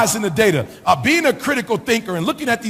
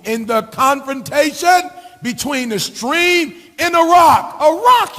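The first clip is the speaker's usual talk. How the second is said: building to a shout; 4 semitones higher, slower than usual. Acted. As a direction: build from steady to a preacher's shout. Same saying preached loud again.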